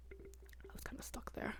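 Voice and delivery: breathy whisper, barely voiced; hushed, defeated whisper; whispered aside. Real, not acted. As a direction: drop to a whisper like a confession. Drops to whisper, recalling helplessness.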